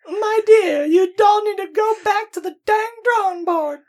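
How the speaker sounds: very high, loud Southern belle voice; a prim, high Southern lady accent; genteel Southern belle. Shown as a demonstration. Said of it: "sophisticated Southern accent".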